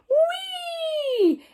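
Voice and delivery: very high squealing whee with a laugh; fly up with joy; gleeful whee. Acted. Bounced into the air.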